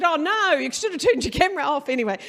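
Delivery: high, loud and fast shocked cry; shocked retort. Retold or imitated. CEO to staffer in robe on Zoom, shocked cry. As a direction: recoil in horror.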